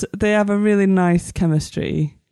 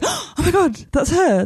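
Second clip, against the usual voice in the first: high-pitched fangirl gasp of delight; acted excited recognition; 9 semitones higher than usual. Viewer spotting Clara on screen, acted excited recognition. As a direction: act out spotting someone you adore.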